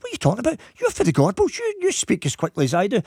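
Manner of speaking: high, scoffing mock of a pal's protest; high indignant scoff; indignant retort. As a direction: toss it back high and incredulous. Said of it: "She said".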